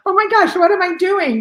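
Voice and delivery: high, loud alarmed cry; retelling her own shock, high and loud; panicked outburst. Retold or imitated. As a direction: blurt it out in sudden panic. Retold panic on first morning.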